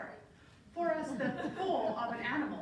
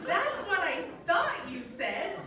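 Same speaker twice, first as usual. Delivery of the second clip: higher, louder, slow disapproving roommate; scandalized roommate; 6 semitones higher, 6 dB louder, slower than usual. Retold or imitated. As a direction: drag it out, judging. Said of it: "then she hung up the phone".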